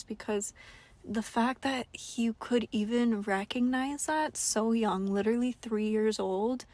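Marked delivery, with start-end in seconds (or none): none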